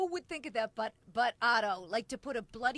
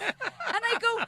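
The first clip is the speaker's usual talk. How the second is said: usual speaking voice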